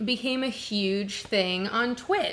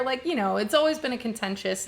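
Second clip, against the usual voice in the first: ordinary talk, nothing marked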